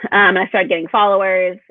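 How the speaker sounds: ordinary delivery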